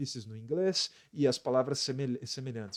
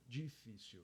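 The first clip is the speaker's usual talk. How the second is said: difícil said quickly and much softer; quiet normal-speed take; 2 semitones lower, 16 dB quieter, slower than usual. Shown as a demonstration. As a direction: toss off difícil softly at normal pace. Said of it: "sete letras e três sílabas".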